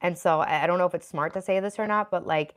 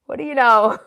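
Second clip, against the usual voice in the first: laughing out the question, louder; 4 semitones higher, 10 dB louder than usual. Real, not acted. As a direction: chuckle through What do you know.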